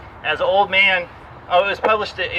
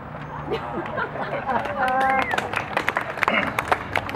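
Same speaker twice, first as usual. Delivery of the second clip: laughing; 8 semitones higher, 5 dB quieter than usual. Real, not acted. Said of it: "audience laughs at the poem".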